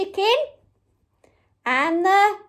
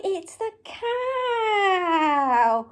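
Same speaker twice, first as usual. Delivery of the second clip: higher, very slow, stretched call; drawn-out reveal; 4 semitones higher, much slower than usual. Pretend. Presenter revealing the last toy to children, drawn-out cheer. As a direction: hold the animal's name long and proud.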